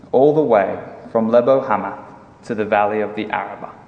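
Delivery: usual speaking voice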